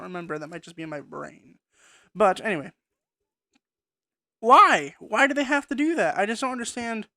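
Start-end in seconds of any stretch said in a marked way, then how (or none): 4.4-7.1 higher, louder exasperated why; frustrated plea, pushed high. Real, not acted. Frustrated outburst about changes.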